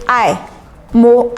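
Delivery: ordinary delivery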